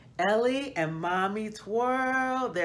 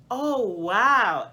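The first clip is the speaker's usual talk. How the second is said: louder, higher amazed gasp of wonder; delighted wow; 4 semitones higher, 6 dB louder than usual. A narrator's reading. A child sees a rainbow, louder and amazed. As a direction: marvel at it with a smile. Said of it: "with a wide smile".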